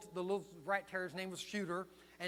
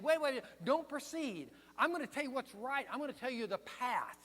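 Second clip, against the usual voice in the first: urgent warning; 6 semitones higher, 3 dB louder than usual. Acted. Jesus voiced pulling them back.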